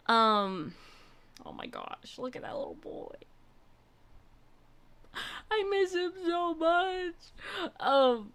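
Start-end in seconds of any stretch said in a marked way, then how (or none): 1.4-3.1 soft whispered adoration; whispered awe. Real, not acted. Seeing the kitten's photo, hushed whisper. 5.5-7.1 high, slow tearful crack; near tears. Real, not acted. Missing the released kitten, high tearful voice. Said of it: "I'm gonna cry".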